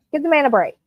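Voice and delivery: usual speaking voice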